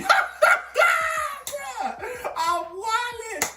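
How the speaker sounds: loud, high, drawn-out laughing outburst; cracking up in disbelief at yourself; laughing hard. Real, not acted. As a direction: burst out laughing through the words. Cracks up at his own story.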